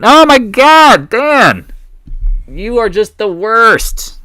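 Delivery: high, loud, slow teasing rant; stretched high mock outrage; mock scolding. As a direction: scold Dan in jest. Scolding a chat member.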